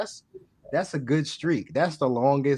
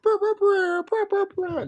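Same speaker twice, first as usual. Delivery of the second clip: high buzzing lip trill; 3 dB louder than usual. Pretend. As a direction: brr it out like a horn. Makes sound effect, searching sound.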